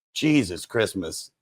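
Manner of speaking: normal talking voice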